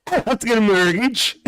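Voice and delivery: high, mocking repeat of the line; a mock desperate plea; mocking whine. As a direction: mimic him pleading.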